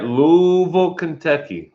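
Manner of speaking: Louisville slurred to "LOO-vul", first vowel drawn out; mouthful of marbles. Shown as a demonstration. Telling viewers how locals say Louisville, mouthful of marbles. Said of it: "Like you got a mouthful of marbles".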